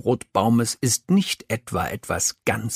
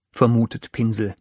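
usual speaking voice

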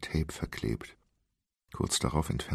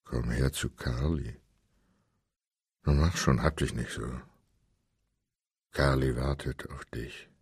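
deep pressed voice; 4 semitones lower than usual. Acted. Captor lures the woman, deep and pressed. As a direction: speak with chin pressed to chest. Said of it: "Sie klang tief und gepresst".

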